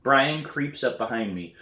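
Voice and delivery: ordinary delivery, nothing marked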